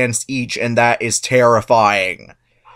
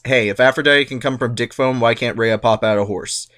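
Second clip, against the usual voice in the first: normal talking voice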